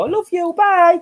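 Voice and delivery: high sing-song I love you, bye; catchphrase goodbye. Retold or imitated. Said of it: "I always go ... catchphrase".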